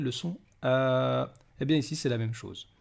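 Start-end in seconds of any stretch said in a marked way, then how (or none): 0.6-1.3 "a" drawn out long; long vowel. Shown as a demonstration. Teacher showing alif as a vowel, "a" held long. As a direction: hold "a" as one lengthened sound. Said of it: "ou aussi en voyelle".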